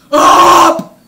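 loud, sharp angry burp. Retold or imitated. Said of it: "an angry sounding burp".

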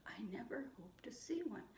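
put-on breathy whispered echo for the kids. Shown as a demonstration. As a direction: whisper the line like a secret. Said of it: "you say it back to me".

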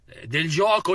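usual speaking voice

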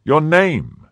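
loud, high, imperious demand; insistent cry. A narrator's reading. Cardinal demands the musketeer's name, loud and insistent. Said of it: "cried his Eminence for the third time".

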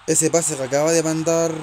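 ordinary talk, nothing marked

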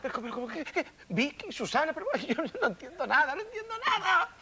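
squeaky, very high whine with a nervous laugh; bewildered wail. Acted. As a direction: break into a high confused whimper. Confused man sees twins. (gemido muy agudo y desconcertado)

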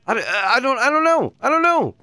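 high exasperated I don't know; baffled. Real, not acted. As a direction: throw up your hands, high and baffled. Baffled by invisible bed.

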